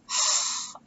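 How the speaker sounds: unvoiced-sounding huff of annoyance; imitated fed-up exhale; exasperated sigh. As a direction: blow out an eye-roll sigh. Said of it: "eye roll thing".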